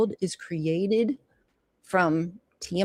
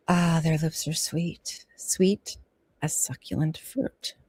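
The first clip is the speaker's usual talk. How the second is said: sensual hush; 3 semitones lower, 5 dB quieter than usual. Acted. Sensual reading, soft.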